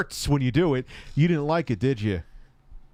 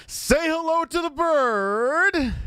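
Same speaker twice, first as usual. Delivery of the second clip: much higher, slow sing-song order; high, drawn-out demand; 4 dB louder, much slower than usual. Pretend.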